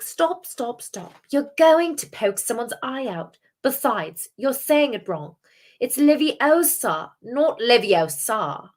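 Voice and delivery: bossy British schoolgirl, high and drawn out; Hermione Granger. Acted. Second try at correcting the spell, bossy British impression. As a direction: correct them in a high, slow British accent. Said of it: "No more Hermione Granger for me".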